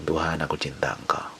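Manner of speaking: whispering to God while driving; Indonesian: doa berbisik; whispered prayer. Shown as a demonstration. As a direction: breathe the words out softly.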